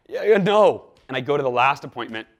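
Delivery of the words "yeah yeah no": exasperated outburst. Retold or imitated. Retold frustration at no-show.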